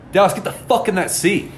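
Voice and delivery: exasperated order. Retold or imitated. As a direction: order a stubborn drunk friend, pitch jumping up. Repeats order after being refused.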